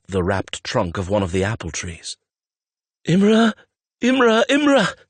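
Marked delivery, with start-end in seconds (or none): 3.1-5.0 high, loud repeated shouts; climbing panicked calls; frantic screaming. A narrator's reading. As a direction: scream the name over and over. Screaming for lost companion.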